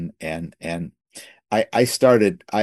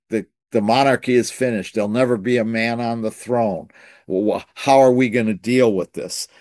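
ordinary talk

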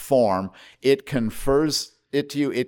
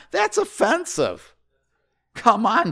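mock offense; 11 semitones higher, 4 dB louder, slower than usual. Mock outrage at Jesus.

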